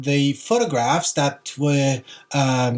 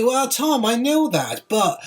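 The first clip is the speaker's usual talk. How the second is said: imagined viewer; 6 semitones higher, slower than usual. Pretend. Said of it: "But you can tell me".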